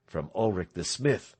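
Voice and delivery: ordinary delivery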